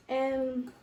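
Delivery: normal talking voice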